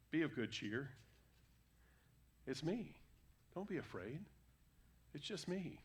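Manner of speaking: softly, soothing frightened friends; calm them, it's only me; gentle reassurance. Acted. Jesus comforting the terrified disciples, soft and gentle.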